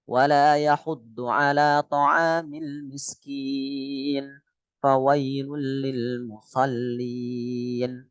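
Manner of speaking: slow, flat tajwid recitation; Quran recitation. Shown as a demonstration. Teacher demonstrating plain tajwid recitation to class, slow chant. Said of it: "ini sebenarnya datar saja".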